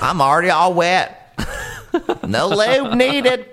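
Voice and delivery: high, drawn-out comic delivery; put-on character voice. Character finishing the pool joke, high put-on voice. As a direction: stretch the punchline up high.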